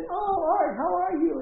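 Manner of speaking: high, loud, gushing acted hello; delighted greeting. Sandy greets Art back like old home week, gushing hello. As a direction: greet him like a long-lost friend.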